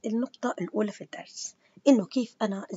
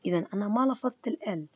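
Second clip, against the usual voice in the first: usual speaking voice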